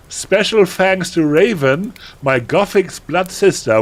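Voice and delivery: put-on high, loud, gushing teenage voice; melodramatic teen author. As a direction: read it like an overexcited goth girl. Reads author's note in character.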